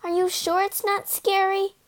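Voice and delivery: small, high, nervous voice; scared little ghost seeking reassurance; shivering. A narrator's reading. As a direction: ask timidly, pitched high. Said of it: "asked the shivering spook".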